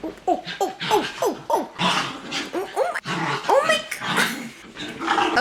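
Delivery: high, rising startled cries; startled oh my God. Real, not acted. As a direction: gasp out in shock as it erupts. Cats fighting on the bed.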